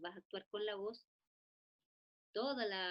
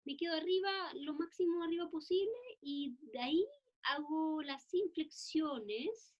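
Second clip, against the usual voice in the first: up high in register; 7 semitones higher, 4 dB louder than usual. Shown as a demonstration. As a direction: voice pushed high, louder. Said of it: "el caso de Chaika es así". (voz arriba, en lo más agudo)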